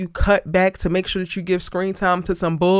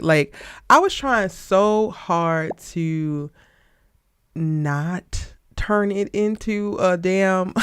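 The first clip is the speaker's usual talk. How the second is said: ordinary talk, nothing marked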